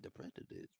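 hushed secret whisper. Real, not acted. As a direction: breathe it like a secret. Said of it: "whispered repeat".

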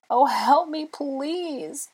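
very high pleading wail, fading at the end; desperate high-pitched plea. A narrator's reading. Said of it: "I wailed".

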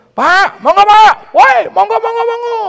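high shouted greeting across the fields; calling out loud. Retold or imitated. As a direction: hail the farmers loudly and cheerfully. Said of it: "saya bilang".

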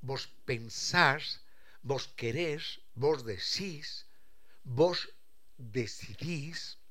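"vos pensás, vos querés" said slowly, spelled out; slow demonstration of Argentine voseo; slow voseo demo. Shown as a demonstration. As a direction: lay out each vos form slowly. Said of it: "que es el vos".

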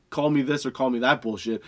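usual speaking voice

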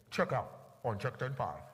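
low, slow checkout announcement; deep, measured tannoy voice; store PA call. Retold or imitated. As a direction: page the bagger over the store intercom. Store intercom call by lady.

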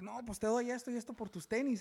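a mock eager bargain offer; pushy trader. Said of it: "He's like".

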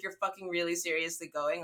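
usual speaking voice

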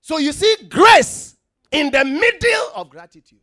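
loud, high, driving sermon voice; full-force preaching. Acted. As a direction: preach it out to the whole church.

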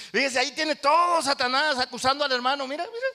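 excited preaching. Acted. Preaching acted scene.

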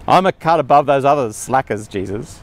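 a hint of a smug, self-satisfied Peter; smug superiority. Preacher mocks Peter's pride in a lightly smug voice. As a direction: play Peter a little full of himself. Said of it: "He's put himself on a real pedestal".

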